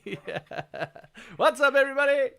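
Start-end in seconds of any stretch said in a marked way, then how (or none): 1.4-2.3 high, bright host greeting; YouTuber hype voice. Pretend. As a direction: pump up the viewers like a vlogger.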